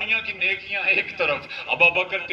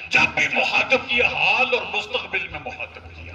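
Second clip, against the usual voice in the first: ordinary talk, nothing marked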